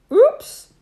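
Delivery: high, loud startled oops; surprised. A narrator's reading. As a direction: yelp it out in surprise. Bump in the story.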